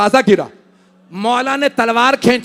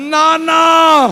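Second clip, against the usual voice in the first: loud, high wailing cry, slow in the middle; crying out in grief; 7 semitones higher, 5 dB louder, much slower than usual. Acted. Hussain crying to his grandfather about his sister, loud wailing lament. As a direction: break into a shouted lament. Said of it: "मौला रोने लगे".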